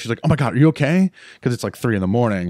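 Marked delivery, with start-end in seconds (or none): 0.2-1.1 higher, louder alarmed voice; imitating a startled friend checking on him; worried roommate. Roommate Anna at his door at 3am, alarmed and louder.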